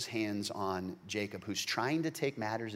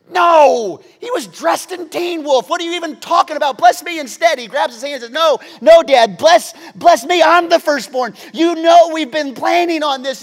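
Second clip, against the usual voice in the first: much higher and louder frantic plea; Esau's desperate shout; 19 dB louder than usual. Acted. Esau begs his father for the blessing, shouting in desperation. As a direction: beg with everything you've got.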